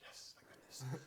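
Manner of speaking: whispered, slow relief; relieved inner thought. Retold or imitated. Performer backstage whispering relief to himself. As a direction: breathe out thank goodness under your breath. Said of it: "I thought".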